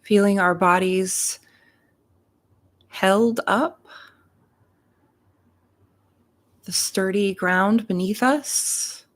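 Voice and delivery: unhurried meditation voice with long pauses between phrases; guided meditation. Real, not acted. Reverend leading listeners into their hearts and breath, guided meditation. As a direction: guide them gently into breath and gratitude, leaving space after each phrase.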